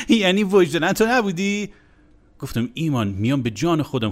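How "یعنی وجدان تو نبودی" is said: higher, teasing Tehrani accent mimicking the colleague; mocking Tehrani accent. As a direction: ham up a smug Tehrani accent. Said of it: "با همون لهجهٔ تهرانی شخمیش گفت".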